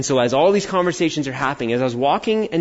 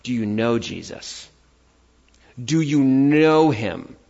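sincere; 4 semitones lower, slower than usual. Real, not acted. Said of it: "preacher's earnest question".

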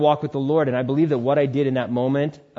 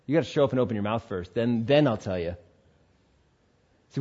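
ordinary talk

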